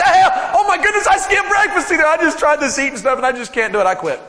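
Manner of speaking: high, loud, hammed-up wail; mock despair. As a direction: shout like someone absurdly giving up over nothing. Mocks quitting over skipped breakfast.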